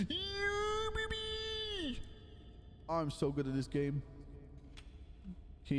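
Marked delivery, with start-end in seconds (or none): 0.1-2.0 high-pitched victory squeal; excited cheer. Real, not acted. Gamer celebrating a lucky win with a high squeal. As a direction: cheer it up high like you just won.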